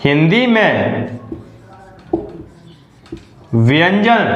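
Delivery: each word drawn out with long pauses; slow dictation. Shown as a demonstration. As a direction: dictate the rule word by word so students can write. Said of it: "dictating the rule slowly". (शिक्षक की तरह धीरे-धीरे नियम लिखवाना)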